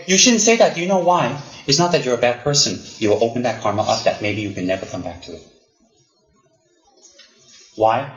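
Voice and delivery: normal talking voice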